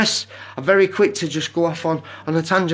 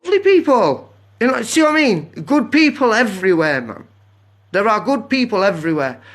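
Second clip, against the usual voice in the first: excited praise; 5 semitones higher, 4 dB louder than usual. Real, not acted. Praising people after signing off friends.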